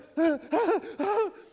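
delighted, high burst of laughter; high excited laugh. Real, not acted. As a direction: laugh out in surprise and joy. Hooking the fish.

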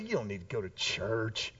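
low, quiet dismissive scoff; mocking. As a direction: brush it off under your voice, ending in a pfft. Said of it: "our culture kinda mocks Sabbath".

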